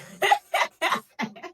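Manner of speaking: high, loud airy laugh; breathy laugh. Real, not acted. Laugh at own joke.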